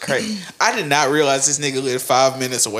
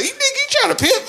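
laughing quote; faster than usual. Retold or imitated. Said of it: "She's like".

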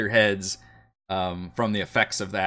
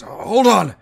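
alarmed call; 11 semitones higher, 9 dB louder than usual. Acted.

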